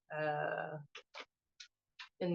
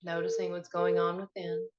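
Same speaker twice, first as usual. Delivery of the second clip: guided meditation; 9 semitones higher than usual. Acted. Host guiding listeners to find their breath, meditation voice. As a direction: lead listeners inward, leaving space after each prompt.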